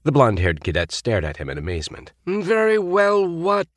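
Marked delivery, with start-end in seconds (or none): 2.2-3.7 slow, sharp demand. A narrator's reading. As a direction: demand an answer. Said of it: "he demanded".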